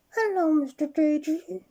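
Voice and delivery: high, loud, slow trumpeting voice; trumpeting elephant. Acted. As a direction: blast it out like through a trunk. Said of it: "trumpeted the elephant down its trunk".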